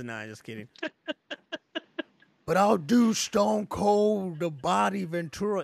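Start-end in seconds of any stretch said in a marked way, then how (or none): 2.5-5.6 loud, slow bad wrestler impression; hammed-up wrestler blend, drawn out; Stone Cold, Jesse Ventura. Shown as a demonstration. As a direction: badly mimic a wrestler, high and booming. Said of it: "It's a real bad Ventura".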